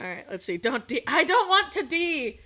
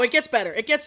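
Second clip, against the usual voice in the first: normal talking voice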